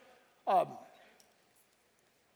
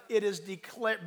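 usual speaking voice

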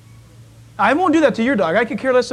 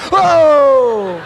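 loud mock yelp with a laugh; dog's yelp; 2 semitones higher, 7 dB louder than usual. Pastor imitating his dog's yelp when the newspaper is thrown. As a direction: yelp like a startled little dog. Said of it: "and he kinda like".

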